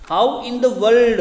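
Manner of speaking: slow, loud, high outcry; a reader's loud amazed cry. A narrator's reading. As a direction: cry it out in astonishment. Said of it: "cried Flambeau".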